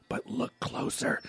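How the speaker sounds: slow stage whisper; imitated movie-villain whisper; hushed hint. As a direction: whisper it slowly, like a secret clue. Retold villainess hinting over video call.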